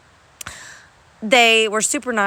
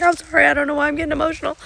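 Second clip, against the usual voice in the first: higher, hurried mock-tearful apology; flustered apology; 6 semitones higher, faster than usual. Crier excusing herself to strangers, flustered apology. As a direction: rush out an embarrassed apology in a raised voice. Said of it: "I was apologizing".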